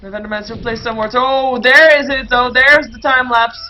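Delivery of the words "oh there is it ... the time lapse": loud, stretched-out exclamation; slow, emphatic caster shout; excited spotting call. Real, not acted. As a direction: hit it big as the play appears. Surprised live call of play.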